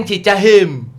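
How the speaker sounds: "Cicaheum" mangled to "Cicahem"; tongue-tied accent. Retold or imitated. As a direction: fumble the name with a tangled tongue. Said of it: "lidahnya belibet".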